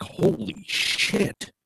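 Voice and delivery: whispered exclamation. Retold or imitated. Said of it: "I'm like".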